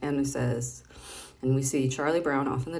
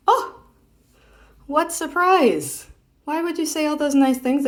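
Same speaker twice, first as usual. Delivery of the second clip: big theatrical oh of surprise; mock surprise; 11 semitones higher, 8 dB louder than usual. Real, not acted. Reacting to the comic's twist.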